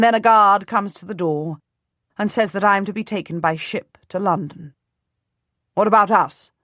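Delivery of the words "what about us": demanding. A narrator's reading. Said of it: "my husband demands".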